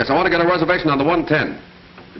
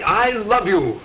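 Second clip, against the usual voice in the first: higher, fuller, insistent delivery; emphatic, meant declaration; 6 semitones higher, much slower than usual. Acted. Husband proving his love to wife, emphatic. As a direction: say it as if you mean it. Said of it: "say it as if you mean it".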